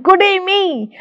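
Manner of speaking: high-pitched, excited little cry. Retold or imitated. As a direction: squeal it with greedy glee. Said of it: "So she cried in excitement".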